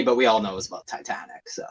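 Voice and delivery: a quick, hushed aside, fast; joking whisper. Real, not acted. Whispering a joke aside to the hosts about the song, quick and hushed. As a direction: whisper the punchline fast under your breath.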